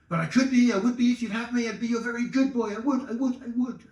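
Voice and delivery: high, pleading puppy voice; eager dog. Acted. Dog pleading with the woman to be his, high and eager. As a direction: beg to be her good boy, bouncing high. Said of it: "it's got two voices".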